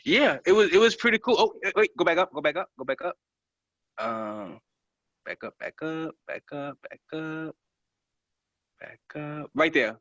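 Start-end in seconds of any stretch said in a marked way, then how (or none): 2.2-9.5 soft muttered repeats; quiet. Real, not acted. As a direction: say it under your breath. Quietly directing scroll.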